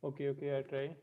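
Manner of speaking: barely audible, far from the mic; weak voice, distant from the microphone; faint voice. Real, not acted. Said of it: "very faint voice".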